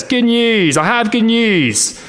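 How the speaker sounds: high, slow joyful proclamation; proclaiming loudly. Acted. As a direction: cry it out from the mountain top. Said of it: "the voice says".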